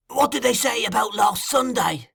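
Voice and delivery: high, insistent question. A narrator's reading. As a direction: press hard in a high voice.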